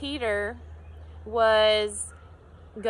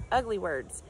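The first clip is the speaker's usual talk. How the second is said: ordinary talk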